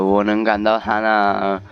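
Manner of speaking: normal talking voice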